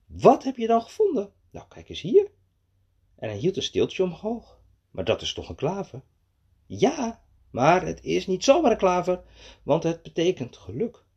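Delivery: animated, high-pitched storybook voices; story dialogue for children. A narrator's reading. Frog and little man discussing the clover, animated storytelling. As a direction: act out the eager exchange for children.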